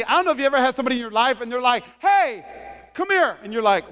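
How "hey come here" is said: high summoning call; raised, acted holler. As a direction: call someone over from a distance. Acts out someone calling another over.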